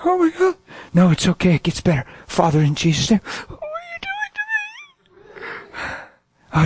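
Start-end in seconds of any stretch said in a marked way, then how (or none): 0.0-0.6 high-pitched astonished cry, acted out; overwhelmed gasped exclamation. Person being prayed for reacts, overwhelmed. 3.5-4.6 tiny, very high squeaky wail, softer; overwhelmed squeal. Retold or imitated. Person reacting to healing prayer, overwhelmed squeal.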